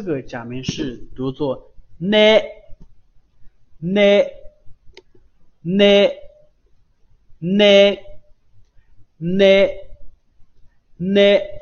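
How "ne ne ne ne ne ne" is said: slow, loud "ne", tongue released quickly into the vowel; pronunciation demo of ne. Shown as a demonstration. Teacher models the fourth kana for learners, slow loud demonstration of "ne". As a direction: say each "ne" separately, held long and loud. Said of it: "刚开始是舌，舌面贴住软腭啊，迅速弹开".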